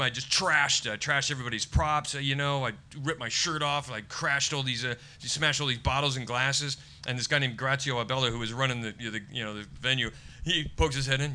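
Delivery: usual speaking voice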